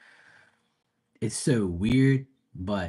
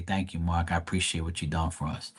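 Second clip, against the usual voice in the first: softer, lower, heartfelt thank you; sincere thanks; 4 semitones lower, 7 dB quieter than usual. Retold or imitated. Imitating mom, softly sincere. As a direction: mom looking son in the eye, sincere. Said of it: "look me in my eye and say".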